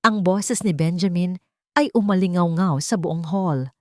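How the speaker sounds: ordinary delivery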